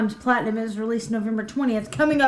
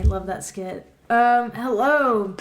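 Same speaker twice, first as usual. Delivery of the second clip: usual speaking voice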